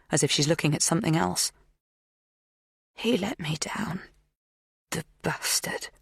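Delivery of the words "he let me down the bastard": slow, hushed bitterness; soft, breathy resentment; bitter whisper. A narrator's reading. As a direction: murmur it, lost in an old grievance. Magda drifts into bitter memory.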